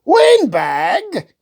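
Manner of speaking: high indignant shout. Acted. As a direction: fling the word back at him. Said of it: "King Hubert shouted".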